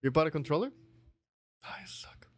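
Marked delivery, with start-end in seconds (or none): 1.6-2.3 breathy whispered jab; playful whispered put-down; teasing whisper. Real, not acted. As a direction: hiss the insult under your breath. Teasing friend about controller.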